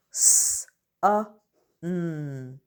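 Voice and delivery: each letter sound said separately, with pauses between. Shown as a demonstration. Teacher to pupils, sounding out a word letter by letter. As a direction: split the word into its single sounds for the class. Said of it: "I'm gonna sound out".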